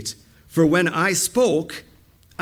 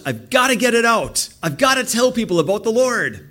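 higher-pitched, urgent outcry; bursting urgency; 6 semitones higher, 3 dB louder than usual. Acted. Jeremiah unable to hold God's word in, urgent outcry. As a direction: burst out as if it can't be held in.

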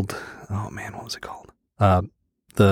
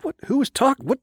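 confused. Retold or imitated. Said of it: "looking around like".

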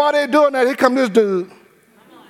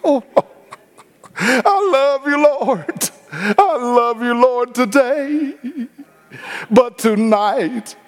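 slow, crooning mock worship to the Lord; hammed-up slow devotion that turns sly; mock tender prayer; 3 semitones higher, much slower than usual. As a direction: drag out the love you like a sweet-talking hypocrite. Mocking hypocrite's prayer.

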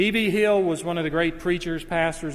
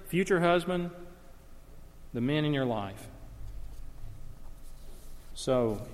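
usual speaking voice

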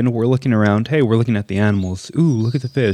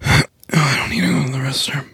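whispered, a little higher, half-hiding; sheepish whisper; 4 semitones higher than usual. Retold or imitated. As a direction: whisper it like a kid hiding something. Son answers sheepishly, whispered.

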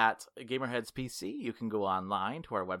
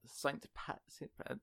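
whispering Saint Pat softly; breathy whispered Saint Pat; 11 dB quieter than usual. Shown as a demonstration. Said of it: "You do it, Mike".

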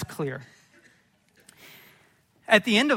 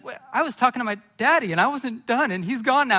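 higher, quicker, dawning panic; mock childish dismay; 6 semitones higher, 5 dB louder than usual. Boy realizes he hung up on his daddy, mock dismayed. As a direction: play a little kid suddenly realizing, half-panicked. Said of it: "I start crying".

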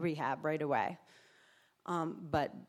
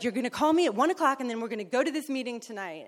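fast, higher bossy sponsor orders; 5 semitones higher, 4 dB louder, faster than usual. Retold or imitated. Sponsor sets plans for the newcomer, fast orders. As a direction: rattle the schedule off quickly. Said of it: "she's like".

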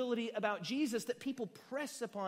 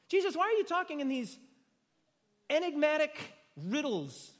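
frustrated disciple; 8 semitones higher, 6 dB louder than usual. Retold or imitated. Disciples' frustrated question to Jesus.

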